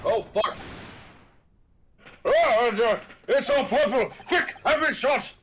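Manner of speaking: high, loud character alarm; acted panic. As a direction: cry out in hammed-up panic. Game character panics at smoke.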